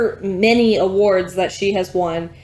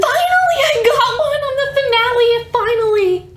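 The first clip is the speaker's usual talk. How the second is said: high, loud cry of triumph; excited shout; 4 dB louder than usual. Real, not acted. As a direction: yell it out, thrilled to finally win. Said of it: "I was so excited".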